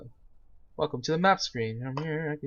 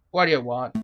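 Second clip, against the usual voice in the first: gruff guard; 5 dB louder than usual. Acted. Prison guard confronting the lawyer at the door, gruff and loud. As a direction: bark it like a surly jailer.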